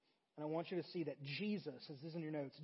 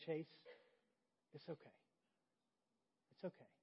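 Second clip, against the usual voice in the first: very soft, slightly lowered voice; gentle reassurance; 3 semitones lower, 13 dB quieter than usual. Retold or imitated. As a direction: forgive a tearful child tenderly, as the teacher. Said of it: "she looked at me and smiled".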